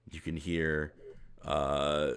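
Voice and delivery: ordinary talk, nothing marked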